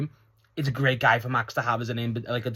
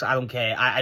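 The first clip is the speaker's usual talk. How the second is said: ordinary talk, nothing marked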